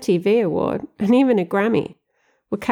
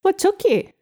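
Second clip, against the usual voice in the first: calling up; 14 semitones higher than usual. Acted. Danny calls down from attic.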